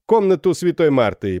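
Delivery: громко, высоко, повелительно; властно приказать. Acted. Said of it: "приказал он". (капитан приказывает старухе, повелительно)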